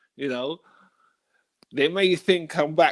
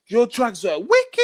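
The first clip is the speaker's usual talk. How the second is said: slow, higher happy praise; lightly voiced buyer raving; hint of an excited buyer; 7 semitones higher than usual. Customer praising the fake drugs, lightly higher voice. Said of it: "the guy says to me".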